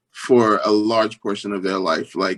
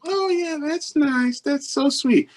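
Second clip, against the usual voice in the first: high-pitched imitation of indulgent parents-in-law; polite in-laws; slower than usual. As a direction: humor them in a mock sweet voice. In-laws politely unimpressed by his news.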